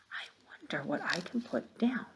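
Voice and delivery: a thinking-aloud whisper. Shown as a demonstration. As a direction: whisper your reasoning to yourself.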